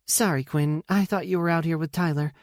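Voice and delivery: caught-out sister saying sorry; apologetic sister. A narrator's reading. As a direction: apologize for a slip. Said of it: "I give her apology".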